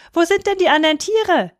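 put-on high-pitched childish question; boy calling up. A narrator's reading. Boy questions the cat above, calling up. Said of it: "rief der kleine Häwelmann hinauf".

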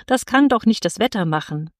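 plain reading voice

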